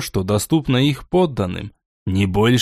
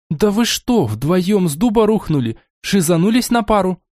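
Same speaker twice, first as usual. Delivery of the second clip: indignant; 6 semitones higher than usual. Acted. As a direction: huff it out in outrage. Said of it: "возмущённо фыркнула Амелия".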